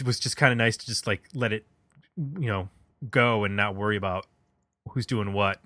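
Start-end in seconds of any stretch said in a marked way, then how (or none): none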